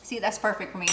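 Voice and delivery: talking while tasting; tasting off spoon. Real, not acted. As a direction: mouth half full of sauce. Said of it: "Just give it a taste".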